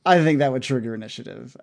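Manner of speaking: ordinary talk, nothing marked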